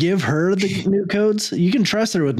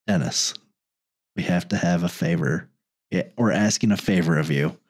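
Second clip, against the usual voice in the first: deep official voice; 9 semitones lower than usual. Retold or imitated. Officials asking Dennis a favor.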